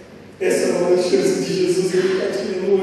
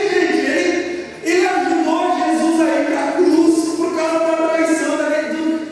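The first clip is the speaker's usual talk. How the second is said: fervent pulpit preaching about a friend who failed; preaching at full force; 11 semitones higher than usual. Acted. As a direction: push the message hard to the congregation. Preaches about Peter's denial.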